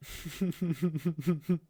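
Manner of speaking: a short mocking chuckle rising at the end; amused chuckle. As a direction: chuckle at her struggling. Villain amused by struggling.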